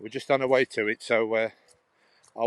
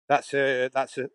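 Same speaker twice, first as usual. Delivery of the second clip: usual speaking voice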